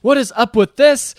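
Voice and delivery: loud, high mock outburst; hammed-up high, loud demand; acting crazy. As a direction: play someone suddenly losing it. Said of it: "starts acting crazy".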